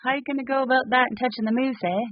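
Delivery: fast, playful mock Canadian accent; mock Canadian accent, showing it off to a friend. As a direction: ham up the Canadian accent, lean on eh. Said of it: "That's my Canadian accent for you guys".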